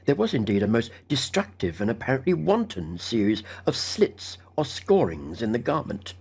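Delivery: plain reading voice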